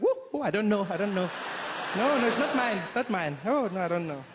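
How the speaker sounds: put-on mildly flustered mom denying the child; mother feigning ignorance. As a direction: play dumb, it's not mine, a touch panicky. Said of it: "She'd be like".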